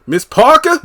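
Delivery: Parker pitched high on a calling rise. Retold or imitated. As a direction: sing out Parker like hailing across the yard. Calling out to the neighbor.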